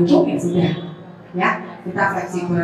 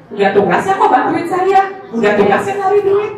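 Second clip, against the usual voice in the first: higher, half-laughing mock of a spouse who takes help for granted; mocking dismissive voice; 10 semitones higher, 3 dB louder than usual. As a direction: tease it up high with a laugh, belittling. (nada mengejek sambil tertawa, lebih tinggi)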